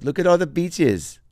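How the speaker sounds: high, drawn-out little voice saying "beaches"; slow, high-pitched mimicry with "beaches" for fishes; mispronounced fishes. Imitating him at the lake pointing out fishes, mispronounced in a high voice. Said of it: "it's coming out as bitches".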